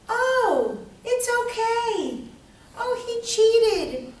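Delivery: high, drawn-out sing-song calm; fake serene. Someone brushing off being cheated on, falsely serene. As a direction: float it up high, slow and sugary. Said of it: "just be just calm and serene".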